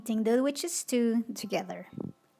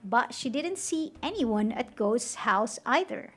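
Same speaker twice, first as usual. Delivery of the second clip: ordinary delivery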